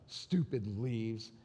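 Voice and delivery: sulky grumble. Retold or imitated. Son grumbling about the leaves, sulky mutter. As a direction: grumble it under your breath.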